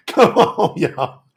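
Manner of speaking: ordinary talk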